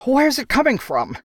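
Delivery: high, gasping, frightened question; breathless gasp. Acted. Crockett, dragged along running, asks: high breathless gasp. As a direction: gasp it out, half panicked. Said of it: "Crockett managed to gasp".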